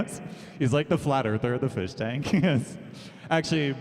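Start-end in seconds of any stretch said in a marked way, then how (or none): none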